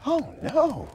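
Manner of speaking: put-on high, drawn child voice; dismayed cry. A narrator's reading. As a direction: gasp it out as a worried girl. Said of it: "cried Victoria".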